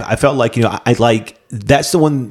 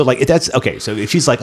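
ordinary delivery

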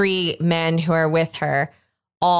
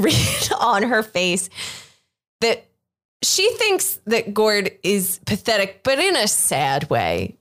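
ordinary delivery

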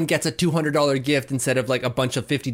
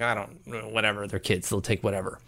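low, soft, offhand retelling; dismissive mumbled reply; 7 semitones lower, 7 dB quieter than usual. Retold or imitated. Recalling his answer about the kids' presents, dismissive and low. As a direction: toss it off quietly, low and noncommittal.

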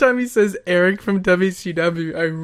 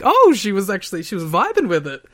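surprised; 4 semitones higher, 6 dB louder than usual. Retold or imitated. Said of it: "I was like".

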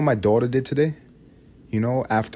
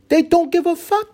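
shrieked punchline; 7 dB louder than usual. Acted. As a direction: scream it as the climax. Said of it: "escalating repetition".

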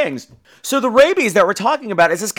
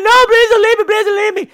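put-on high, loud pleading girl voice; panicked girl; 5 dB louder than usual. Trapped girl begging her boyfriend to stay, high panicked plea. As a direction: beg him not to go, frantic. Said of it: "And she's like".

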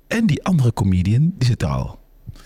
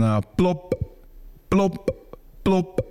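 mocking plop sounds; 7 semitones higher, 4 dB quieter, much slower than usual. Imitating other comedian's soundcheck.

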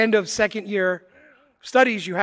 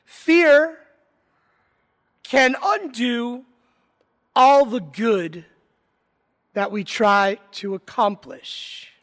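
solemn preaching; 2 semitones higher, slower than usual. Acted. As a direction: preach it slowly, leaning on fear. Opening of the homily.

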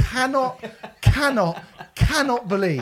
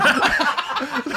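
short high laugh; 10 semitones higher, 3 dB louder than usual. Real, not acted. Mocking the film with friends, a high laugh.

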